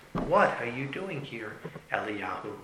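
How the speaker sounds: slow, hushed question fading away; small, whisper-like voice. Acted. A small voice questions Eliyahu at the cave, hushed. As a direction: ask softly and slowly, sinking on the name. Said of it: "a voice which was a small whisper".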